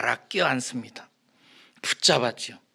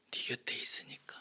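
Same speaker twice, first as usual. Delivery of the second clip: whisper; 13 dB quieter than usual. Acted. Preacher's hushed answer.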